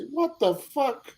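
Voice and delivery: high, slow, drawn-out Welsh lilt; Welsh twang. Retold or imitated. Retelling the flooded road to friends, mock Welsh twang. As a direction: stretch it out in a hammed-up Welsh accent. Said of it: "I even say it now with a Welsh twang".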